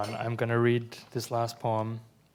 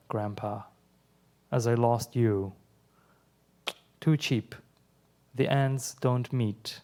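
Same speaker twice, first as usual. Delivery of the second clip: measured, deliberate poem reading with pauses between lines; steady poetry-reading voice; 4 dB louder than usual. Acted. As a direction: read each line as a poem, letting it land. Reads own poem aloud.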